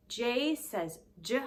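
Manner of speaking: slow "J says J", the sound stretched and stressed; letter sound demo. Shown as a demonstration. Teacher sounding out J for kids, slow and clear. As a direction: spell out the sound slowly for little ones. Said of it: "It begins with the letter J".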